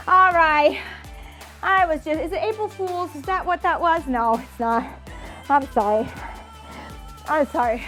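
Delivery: ordinary talk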